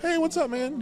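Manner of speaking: high, breezy imitation of a guy saying hi; imitating Tony Romo's friendly greeting, pitched high; mock bro greeting. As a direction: toss off a casual high hello.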